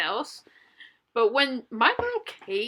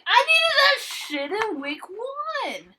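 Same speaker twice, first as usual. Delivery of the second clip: sassy; 9 semitones higher, slower than usual. Acted. Mock-scolding the dancer.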